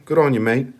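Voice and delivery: light put-on Australian accent; imitation Australian accent. Shown as a demonstration. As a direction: toss it off in an Aussie accent. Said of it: "bit of an Australian thing".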